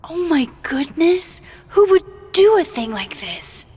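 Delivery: playing innocent with a hammed-up outcry; mock shock. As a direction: feign innocent shock, loud and high. Player feigns shock after prank.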